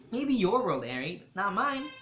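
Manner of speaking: lightly voiced teen, teasing; teasing retort. A narrator's reading. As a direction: tease him with a playful line. Dante's retort.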